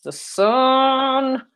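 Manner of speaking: high, lofty, slowed declaration; playful fake-solemn announcement; mock grand proclamation. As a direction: pronounce it like a grand cosmic truth, tongue in cheek. I'm just kidding.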